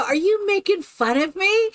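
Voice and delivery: retold challenge, pitched right up; mock indignant question. To the girl mimicking the meditation, mock indignant.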